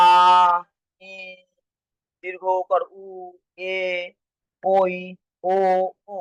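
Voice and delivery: আ, ই, উ, এ, ওই, ও each held, with gaps between; long vowels. Shown as a demonstration. Teacher listing vowels that take longer, each one drawn out. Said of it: "উচ্চারণ করতে গেলে দীর্ঘ সময় লাগে".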